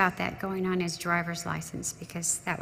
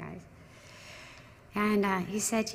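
usual speaking voice

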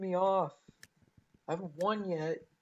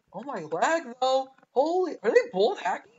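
higher, louder mock panic; retold alarm; 6 semitones higher, 8 dB louder than usual. Retelling his own panic at the laggy fight, mock alarm. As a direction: replay your own startled reaction. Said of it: "I'm like".

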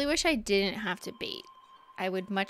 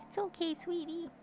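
soothing pet talk; 7 semitones higher, 4 dB quieter than usual. Real, not acted. Soothing cat.

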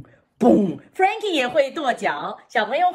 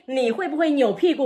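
ordinary talk

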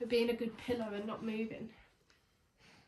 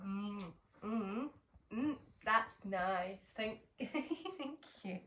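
usual speaking voice

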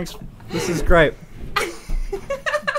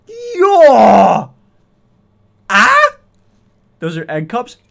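excited; 7 semitones higher, 11 dB louder, slower than usual. Real, not acted. Excited about the shot glasses.

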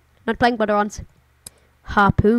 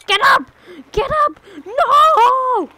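shout; 9 dB louder, much slower than usual. Real, not acted. Game character about to crash.

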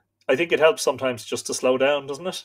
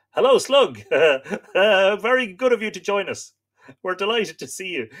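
high, louder laughing hello; laughing greeting; 7 semitones higher, 5 dB louder than usual. Real, not acted. Host greeting a viewer's stuffed slug, laughing. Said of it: "greeting a viewer's toy".

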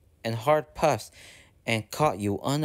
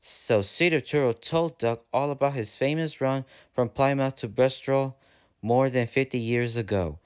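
plain reading voice

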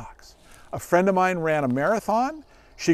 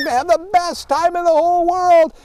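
pitched way up, gushing; silly high voice; 13 semitones higher, 6 dB louder than usual. Shown as a demonstration. Trainer showing children his dog-reward voice, high and silly. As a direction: sound like the happiest person alive. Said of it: "why am I talking in a silly voice like this".

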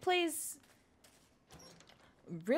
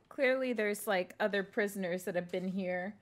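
ordinary talk, nothing marked

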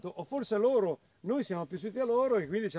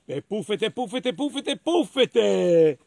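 bouncing plops building to a loud finish; plopping droppings; 6 dB louder, much slower than usual. Acted. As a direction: let each puffete land harder. Sound of the horse's droppings.

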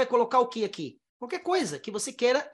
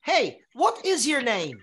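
slow, clear; slower than usual. Shown as a demonstration. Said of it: "que a pessoa vai falar".

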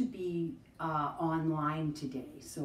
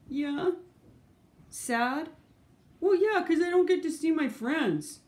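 high, loud puppet answers; 7 semitones higher, 9 dB louder than usual. Acted. Puppet answers feelings questions.

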